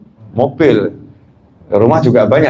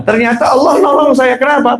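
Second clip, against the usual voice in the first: preaching shout; 11 semitones higher than usual. Real, not acted. Preacher's climax to congregation.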